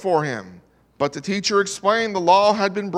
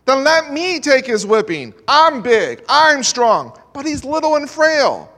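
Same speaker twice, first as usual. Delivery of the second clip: loud, high pleading offer; crying out; 9 semitones higher, 9 dB louder than usual. Retold or imitated. Bully begs teacher to take the whipping, loud high plea. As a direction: cry out to take his place. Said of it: "Jim cries out".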